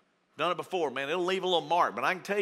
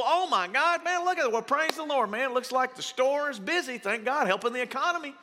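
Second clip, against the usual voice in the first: mock delighted; 8 semitones higher than usual. A driver praising God for a full lot, in hammed-up glee. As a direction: gush with exaggerated thanks.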